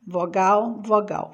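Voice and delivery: usual speaking voice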